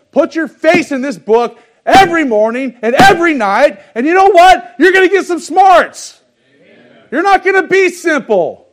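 high, loud preacher's shout; preaching at full force. Acted. Preacher urging the flock to read the Bible, loud and high. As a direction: drive it home like a revival preacher.

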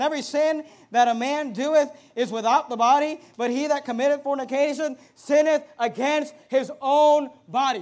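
loud, punched pulpit reading; emphatic preacher reading aloud; forceful scripture reading. Real, not acted. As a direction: declaim the verse, stressing key words.

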